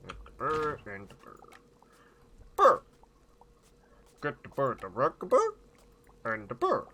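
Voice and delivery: lilting mock-Swedish babble, fading into little syllables; playful fake Swedish nonsense; Swedish Chef voice. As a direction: burble in a comic Swedish accent, then trail away. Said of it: "the Swedish Chef voice".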